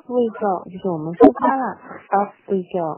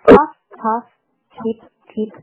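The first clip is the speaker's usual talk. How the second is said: punchy puffs, short separated peeps; train sound imitation; 3 semitones higher, 10 dB louder than usual. Shown as a demonstration. Teacher imitates the train for children, short bursts. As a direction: puff out each word like a steam train. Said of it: "模仿这个火车的声音".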